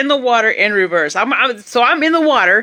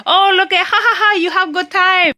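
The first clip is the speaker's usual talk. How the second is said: high, loud voice of a delighted local man; excited local; 10 semitones higher, 4 dB louder than usual. Retold or imitated. Local man showing off his fish to a tourist, excited. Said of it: "and he's like".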